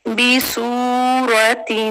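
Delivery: बी, सूरतिन slow, सू held long; slow recitation; tajweed recitation. Shown as a demonstration. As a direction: hold the वाव मद्दा in सूरतिन. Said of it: "वाव मद्दा".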